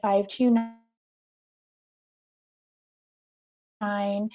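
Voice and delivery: choppy call audio with dropouts; words cut by a failing connection; breaking up. Real, not acted. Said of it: "You're, you're breaking up quite a bit".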